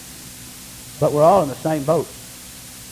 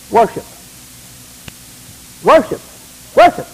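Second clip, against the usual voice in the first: each worship higher and louder; building preaching cry; 6 semitones higher, 6 dB louder than usual. Real, not acted. Pastor answering how to gauge change, building to a shout. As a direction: climb to a high shout.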